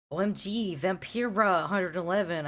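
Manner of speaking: high excited yell; hammed-up excited yell; mock yell. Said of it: "I yelled".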